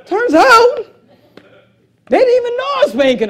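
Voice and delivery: high, loud words broken by real laughter; laughing. Real, not acted. Preacher to congregation, laughing at his own joke. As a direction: laugh through the punchline.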